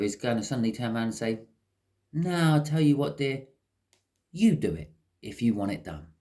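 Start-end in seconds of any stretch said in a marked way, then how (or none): 2.1-6.1 higher, louder and defiant; standing up for themselves. Retold or imitated. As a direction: finally snap back at the partner, firm and fed up. Meek partner finally stands up.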